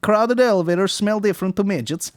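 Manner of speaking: high, loud mock Russian accent. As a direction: play a Russian joke teller. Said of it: "the Russian guy".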